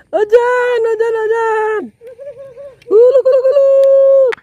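very high excited yelps; excited high cries. Real, not acted. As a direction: squeal it out excitedly. Heboh saat ikan menarik. (berseru heboh bernada tinggi)

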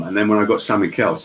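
ordinary delivery

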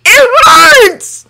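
high, loud cry of pain. A narrator's reading. Hiccup in pain, eyes shut.

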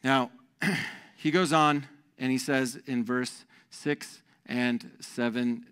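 usual speaking voice

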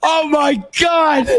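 mock horror. Captive discovering chains, drawn-out mock-horrified cry. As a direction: exclaim in hammed-up dismay.

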